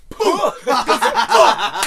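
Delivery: loud, high explosive "poof"; hammed-up expulsion sound; popping-out noise. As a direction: burst it out like a cork. Imitates chunk popping out.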